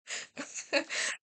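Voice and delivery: airy unvoiced laugh; short breathy giggle; breathless laugh. Real, not acted. Laughs at own voice acting.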